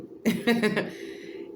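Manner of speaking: a breathy giggle after the name; short laugh. Real, not acted. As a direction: laugh at your own intro.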